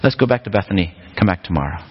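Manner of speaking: a lightly voiced Jesus calling it a day; casual shrug. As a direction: anticlimactic and matter-of-fact. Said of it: "looks around and goes".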